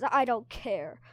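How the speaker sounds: loud, sharp cut-in over his words; cutting in loudly. Acted. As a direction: shout him down mid-sentence. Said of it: "Burn interrupted".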